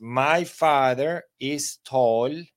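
very slow, drawn-out model line; slow demo read. Shown as a demonstration. Teacher reading the example to students, very slow. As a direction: stretch each word for the class.

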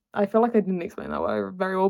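ordinary delivery

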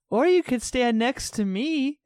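high, slow flirty put-on voice; mock sultry nurse voice; quoting Nurse Julie. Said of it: "Nurse Julie's like".

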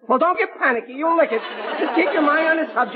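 high-pitched, fretful reassurance; anxious encouragement. Acted. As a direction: urge him on while fretting yourself. Anxious father urging struggling son on.

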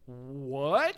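ordinary talk, nothing marked

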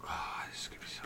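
hushed worried whisper. Real, not acted. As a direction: breathe it out under your breath. Dreading the Bengals injury news.

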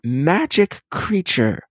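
high, slow wondering voice; awestruck child. Retold or imitated. Toddler seeing a sparrow, awestruck. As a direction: gape like a toddler. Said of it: "watch my son go like".

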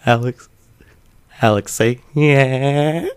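struggling to contain himself. Retold or imitated. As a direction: imitate someone bursting to get a word out. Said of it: "struggling to contain himself".

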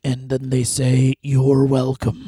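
slow, gravelly voice-over impression; low, drawn-out mock pronouncement; Freddie Blassie. Co-host joining the voice-over parody, deep and gravelly. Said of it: "that's Freddie Bla".

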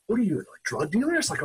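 high-pitched incredulous question; mock-accuse him in astonishment; retold disbelief. Stunned question to wealthy friend.

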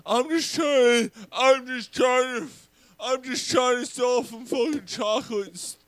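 high, drawn-out mock child voice; little fat kid. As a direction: plead slowly like a hammed-up little boy. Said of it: "and the little fat kid".

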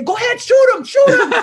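high, loud laughing yell; mock shout. Brother dares the cop to shoot, high loud yell. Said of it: "wanted to jump through the window at the guy and say".

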